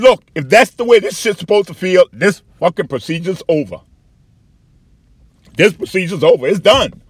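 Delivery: loud, high-pitched retold protest; angry ultimatum. Patient telling doctor the procedure is over, angry and loud.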